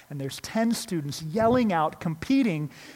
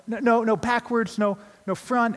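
higher and louder, a mock panicked correction; frantic partner call; 6 semitones higher, 4 dB louder than usual. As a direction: call out fast no-no-no, hammed-up alarm.

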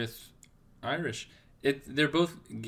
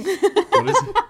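high laughing squeal, much louder; 12 dB louder, slower than usual. Real, not acted.

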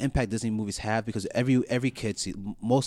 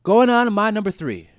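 higher and louder, hyped up; loud hype announcement; 10 semitones higher, 8 dB louder than usual. Acted. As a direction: call it out like a show host. Announcing the next ranking.

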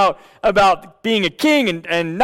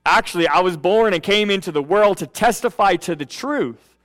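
scripture reading; 3 semitones lower than usual. Acted. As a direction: read the passage with hushed reverence. Reads scripture aloud.

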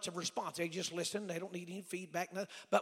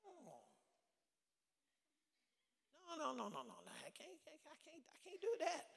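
high, soft, stammering protest, re-enacted; distraught refusal; 9 semitones higher, 10 dB quieter than usual. Young student told that is ministry, imitated distraught protest. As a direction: whimper a stuttered refusal, high and small.

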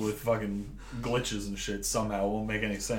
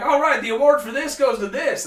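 high, loud mock announcer; hammed-up award announcement; awards presenter; 12 semitones higher, 14 dB louder than usual. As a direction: call out the winner, pitched way up. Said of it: "They're like".

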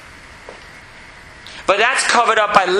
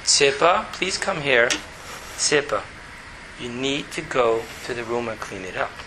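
much lower, softer, calm and even; tone brought down; 7 semitones lower, 7 dB quieter than usual. Shown as a demonstration. Father telling his daughter to clean her room, low and calm. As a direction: keep it low and steady, no yelling. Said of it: "bring your tone really down".

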